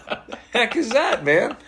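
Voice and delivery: high, laughing disbelief; a startled newbie reacting to odd beeps, half laughing; baffled exclamation. Actor retelling his first ADR session, high baffled laugh.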